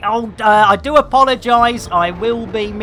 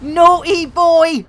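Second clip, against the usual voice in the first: mock scolding; 9 semitones higher, 5 dB louder than usual. Scolding the enemy pilot.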